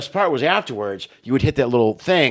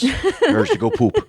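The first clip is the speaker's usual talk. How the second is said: high, slow, laughing through the words; 12 semitones higher, slower than usual. Retold or imitated. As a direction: laugh while telling your child. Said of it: "I go".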